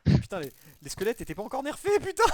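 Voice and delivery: loud panicked swearing; alarmed cursing. Real, not acted. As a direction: curse out in alarm. Skeletons attacking, panicked cursing.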